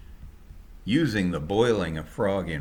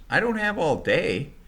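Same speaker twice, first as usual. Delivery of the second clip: impatient yell; 9 semitones higher, 4 dB louder than usual. Acted. Impatient driver yelling at packers.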